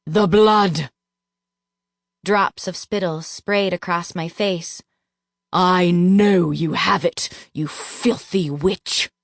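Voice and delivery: slow, forceful spitting accusation; angry accusation. A narrator's reading. Duke spitting rage at narrator, slow forceful. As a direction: bite down on filthy witch.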